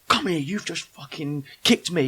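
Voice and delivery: indignant confrontation, acted out; mildly heated mock challenge; retold aggro. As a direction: replay squaring up to someone who wronged you.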